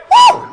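loud, very high "woo"; high excited whoop. Real, not acted. Preacher carried away mid-sermon, high excited whoop. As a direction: let out a sudden piercing whoop.

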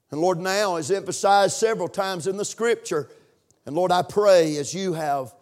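impassioned public prayer, building in intensity; fervent prayer. Real, not acted. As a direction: pray it out with force, stretching key words and pausing between phrases.

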